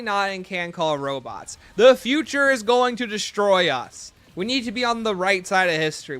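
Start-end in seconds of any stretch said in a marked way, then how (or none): 1.8-6.2 mock rally speech. Mock-serious robot rights speech.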